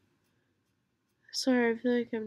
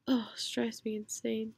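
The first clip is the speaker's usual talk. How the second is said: whisper. Real, not acted.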